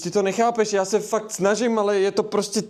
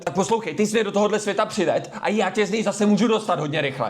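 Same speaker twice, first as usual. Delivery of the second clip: usual speaking voice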